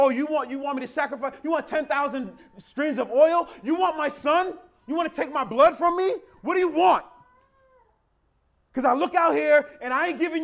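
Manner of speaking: high, loud sarcastic demands piling up; escalating exasperation. Acted. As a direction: build from pointed questions to a shouted, fed-up demand of God. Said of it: "There's a progression here".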